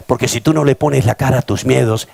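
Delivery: ordinary talk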